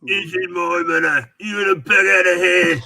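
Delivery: hammed-up Cockney shout. Imitating co-host warning off an intruder, mock Cockney. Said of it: "That's a very good Cockney accent".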